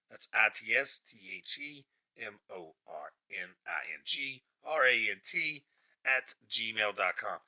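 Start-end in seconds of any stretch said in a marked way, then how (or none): 0.3-5.6 spelled out letter by letter. Shown as a demonstration. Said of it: "Email me. It's the Morning Rant".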